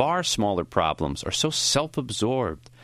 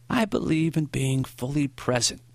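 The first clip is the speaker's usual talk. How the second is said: higher, lightly voiced old man; a hint of an elderly teacher, voice raised in pitch; Maury, earnest old teacher; 5 semitones higher than usual. A narrator's reading. Old teacher advising his former student, earnest.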